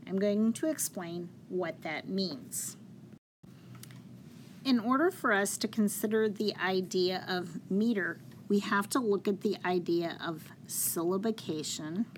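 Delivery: ordinary talk, nothing marked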